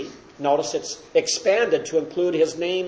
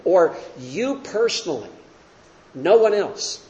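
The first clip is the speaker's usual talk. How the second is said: usual speaking voice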